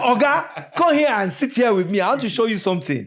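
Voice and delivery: high, loud mock Nigerian accent; hammed-up, insistent Nigerian banter; Atse. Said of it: "very heavy Nigerian accent".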